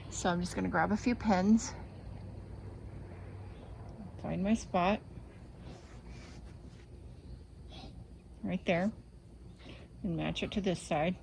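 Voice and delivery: talking around a pin held in lips; mumbled past a pin in the mouth. Real, not acted. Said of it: "I've got a pin in my mouth".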